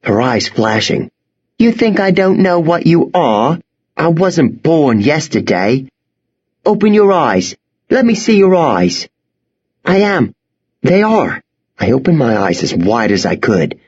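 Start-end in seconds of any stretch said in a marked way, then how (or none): 1.6-9.1 high, fierce and suspicious; fierce girl. Acted. Angry girl with knife. 9.8-11.4 put-on scared boy.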